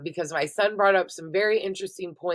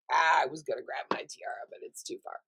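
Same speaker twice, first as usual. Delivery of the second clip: breathy whisper under the breath; whispered aside; 3 semitones lower, 6 dB quieter than usual. Real, not acted.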